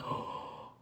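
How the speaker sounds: sharp gasp into a breathy oh; startled gasp. Acted. Imagining Jesus feeling power leave him, startled breathy gasp. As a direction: gasp as if something just left you.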